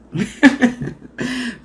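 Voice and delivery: short chuckle mid-sentence; laugh. Real, not acted. Host stumbling over the day count, short laugh.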